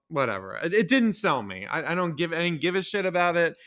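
ordinary talk, nothing marked

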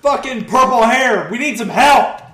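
loud, high shout across chaos; yelling for help. Acted. Player calling a purple-haired stranger for help, urgent shout. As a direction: holler for someone's attention.

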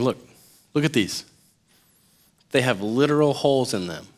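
soft, unhurried coaxing; gentle reassurance. Acted. Jesus inviting doubters to touch his wounds, tender. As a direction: soothe a hesitant friend, softly.

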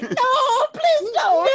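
high, loud hammed-up begging; mock terrified pleading. Patient begging a shady doctor, mock-terrified shrieking. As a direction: wail a plea through laughter.

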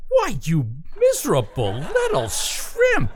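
angry outburst. Acted. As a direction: turn on him, shouting. Bill turns on George.

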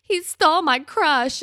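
high, slow mock heartbreak; sad puppy dog. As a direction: imitate a wounded puppy dog, drawn out. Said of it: "like, the saddest little puppy dog".

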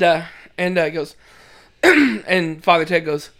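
ordinary talk, nothing marked

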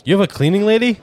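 surprised question. Real, not acted. Incredulous question, first take.

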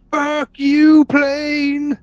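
high, drawn-out shout at the plane; mock furious yell. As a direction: yell it out, hammed-up rage. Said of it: "Tom Cruise in the ocean going".

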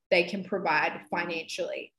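normal talking voice

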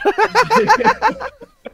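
loud high burst of laughter; real loud laughter; big laugh. Real, not acted. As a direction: crack up loudly. Laughing at colleague's blank.

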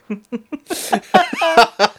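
high-pitched burst of laughing; gleeful laughter. Real, not acted. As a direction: laugh high at someone's expense. Laughing at the bait being taken.

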